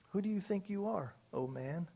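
a hushed, pointed question; soft rebuke. Acted. God quietly rebuking the questioning man, soft and pointed. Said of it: "we hear the rebuke".

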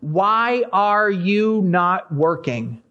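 drawn-out, very slow plea; slow lament. Retold or imitated. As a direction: stretch each word like a weary complaint. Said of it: "he cries out to God and says".

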